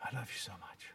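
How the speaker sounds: soft, breathy whispered endearment, fading to a whisper; tender whisper. Pretend. Husband to his wife, a soft whispered endearment. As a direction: murmur her name twice, then whisper the love. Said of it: "I never say".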